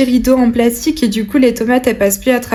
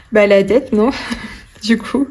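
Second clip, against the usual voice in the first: normal talking voice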